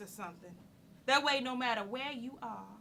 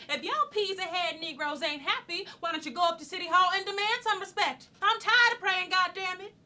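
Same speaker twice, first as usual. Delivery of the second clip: high, rising outburst, louder by the end; fed-up protest; 7 semitones higher, 5 dB louder than usual. Acted. Woman urging townsfolk to City Hall, fed-up protest. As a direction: speak out boldly, building to exasperation.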